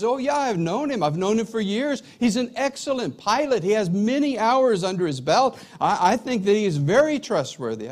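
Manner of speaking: high, animated endorsement of a friend; enthusiastic vouching. Acted. Acted phone reference for the pilot.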